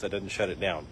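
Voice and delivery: ordinary talk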